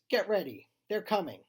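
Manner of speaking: urgent loud warning yell. A narrator's reading. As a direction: shout a high-pitched warning to friends. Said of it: "sprints back to the others and yells".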